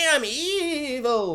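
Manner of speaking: slow, hammed-up evil villain line; campy evil gloat; mock villain. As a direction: purr it like a cartoon schemer. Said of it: "budget Littlefinger he's all like".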